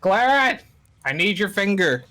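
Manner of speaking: loud, high call to a companion. Acted. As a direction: shout for your party member. Calls out to a party member.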